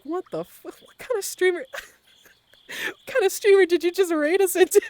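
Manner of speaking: high, imitated disbelief breaking into laughter; laughing mock outrage. Imagined raiders questioning their streamer, laughing mock disbelief. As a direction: play baffled raiders while cracking up.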